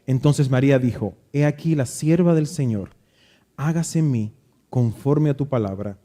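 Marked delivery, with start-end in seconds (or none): none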